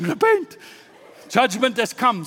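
high-pitched shouted street warning; shouting. Acted. You run into the city, shouting repentance. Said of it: "you start shouting".

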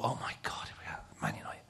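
hushed awe sinking to a whisper; nervous whisper. Retold or imitated. Nervous player thinking about facing Man United, whispering. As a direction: drop to a whisper, talking to yourself. Nervous self-talk before kick-off.

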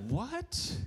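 high squeaky confused "what"; bewildered little boy's rising what; puzzled small kid, high and laughing; confused child. Retold or imitated. Child's confused reaction voiced.

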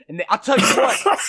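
usual speaking voice

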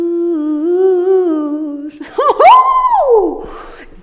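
high, loud burst of laughter. Real, not acted. Laughing hard after her own sung line. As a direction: crack up at herself.

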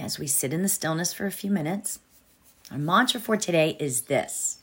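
calm mantra recitation; guided meditation. Acted. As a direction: recite the mantra gently and evenly.

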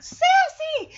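high, loud shout of the name; joyful recognition. Acted. Dogs recognizing their lost cat, overjoyed shout. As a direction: shriek the name in delight. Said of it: "And it's like".

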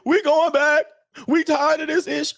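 high mocking voice of quitting men; mock whiny quote. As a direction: mimic them high and whiny. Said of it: "y'all gotta be".

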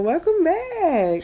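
drawn-out greeting. Acted. Greeting listeners.

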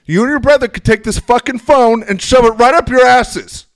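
high, loud outburst; mother yelling at her children over the phone, mock fury; mock furious mom. Mom's furious outburst, retold.

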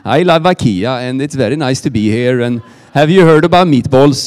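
hammed-up Swedish accent. Shown as a demonstration. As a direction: put on a thick comic Swedish accent. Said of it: "that's how the Swedes sound".

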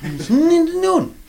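exasperated punchline. Real, not acted. Comedian crying out about time to the audience, high and strained. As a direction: wail it in despair.

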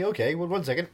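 ordinary delivery

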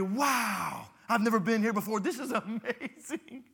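high, loud wide-eyed wonder; mock amazement. Preacher playing a tourist in the bush, mock amazed. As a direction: gush like a first-time tourist.

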